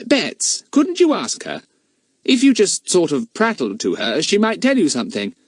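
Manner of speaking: put-on boyish, thoughtful suggestion; boy with a plan, lightly voiced; Fatty's voice. Fatty coaxing Bets.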